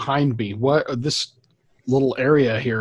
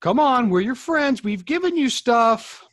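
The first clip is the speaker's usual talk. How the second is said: higher, pleading coax; 9 semitones higher than usual. Acted. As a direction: talk the creature down gently, pitched up. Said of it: "try to persuade him to calm down".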